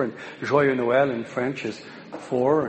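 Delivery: usual speaking voice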